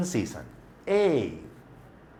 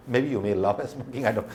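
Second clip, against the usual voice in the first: ordinary talk, nothing marked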